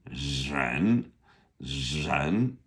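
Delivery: "Zhen" with drawn-out buzzing initial, low then rising; SH meeting R. Shown as a demonstration. Teacher demonstrating the hard initial again, SH meeting R. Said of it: "like an S H meets an R".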